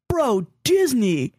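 slow, savored mock amazement; drawn-out dude-bro awe; mock excited boyfriend. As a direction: lightly play the awestruck bro. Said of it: "he's like".

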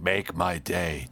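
low gravelly tough-guy whisper; mock Eastwood growl; Clint Eastwood impression. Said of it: "doing this weird Clint Eastwood thing".

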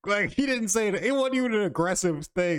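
high and loud, worked up; excited. Real, not acted. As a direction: push it out high and loud, amused. Excited retelling while laughing.

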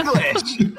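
laughing. Real, not acted. Laughing while retelling the kid.

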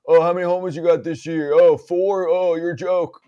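imitated sarcastic taunt from the stands; yelling fan. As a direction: jeer like a fan mocking a player. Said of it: "how many fans yell at players".

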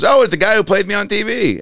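imitating Leno greeting; Jay Leno impression. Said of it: "and he goes".

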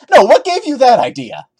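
ordinary talk

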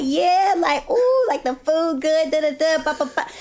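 high, excited mock hype of a friend; hyped-up imitation. Imitating the co-host hyping her up, high and excited. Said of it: "you were hyping me up".

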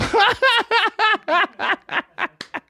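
high burst of laughter. Real, not acted. Laughing at girlfriend's remark.

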